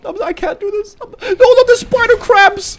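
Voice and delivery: very high crying wail; panicked wail. Real, not acted.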